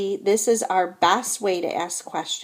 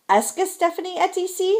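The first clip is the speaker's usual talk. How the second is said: raised, high-pitched question on "Est-ce que Stephanie est ici"; question intonation; 10 semitones higher than usual. Shown as a demonstration. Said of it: "sentences on the right are questions".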